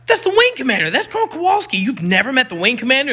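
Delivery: high, loud disbelief; can't believe they never met him; mock-astonished explaining to friends; incredulous. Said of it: "And I was like".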